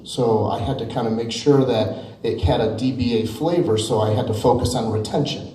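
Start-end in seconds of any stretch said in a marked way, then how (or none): none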